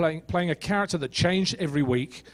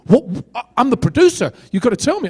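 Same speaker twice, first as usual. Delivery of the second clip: higher, louder mock outrage; shocked producer; 8 semitones higher, 6 dB louder than usual. Producer hearing her actor refuse on the phone, mock-outraged. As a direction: imitate a flabbergasted boss, spluttering. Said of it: "she almost dropped dead".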